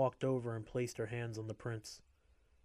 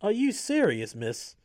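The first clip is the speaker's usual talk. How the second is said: incredulous shout; 10 semitones higher, 11 dB louder than usual. A narrator's reading. Guard scolds inmate loudly.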